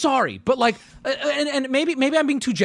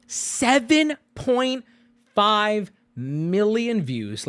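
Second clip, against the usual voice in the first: slow dramatic reveal; much slower than usual. Real, not acted. Reading stats for effect.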